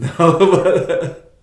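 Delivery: laughing while trying to remember. Real, not acted. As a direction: chuckle at the memory. Recalling crowded room, laugh heard.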